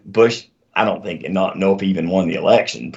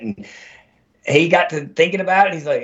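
ordinary delivery

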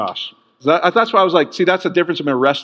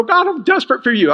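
mock bawling; 7 semitones higher than usual. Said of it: "What are you bawling about?".

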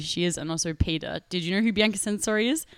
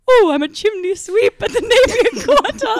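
high, loud, laughing put-on voice; the girlfriend joking while sweeping, high and giggling; mocking chimney sweep; 10 dB louder than usual. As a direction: ham up a giddy chimney sweep through laughter. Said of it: "being like".

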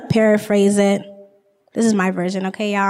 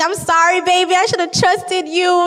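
sweet apology; 7 semitones higher than usual. Retold or imitated. Joseph apologizing to Mary.

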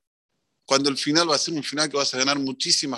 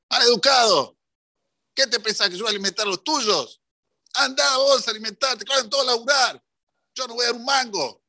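angry yelling; 8 semitones higher than usual. Retold or imitated. Rich man yells at the yeshiva fundraiser, furious shout. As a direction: scold him like he insulted you. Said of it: "Y así gritaba y gritaba".

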